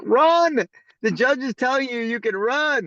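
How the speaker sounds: high-pitched, exasperated urging to flee; urgent plea. Retold or imitated.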